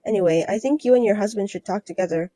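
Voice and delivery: usual speaking voice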